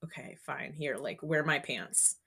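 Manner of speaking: soft, grudging sigh of a reply; weary sergeant. Retold or imitated. Platoon sergeant to the cadet, softly and grudgingly. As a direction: hand it over with a reluctant sigh. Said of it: "And she's like".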